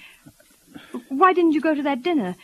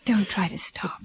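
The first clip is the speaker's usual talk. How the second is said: comforting hushed whisper; whispering comfort to a weeping man; tender whisper; slower than usual. Acted. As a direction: soothe him through his tears. Comforting crying Michael.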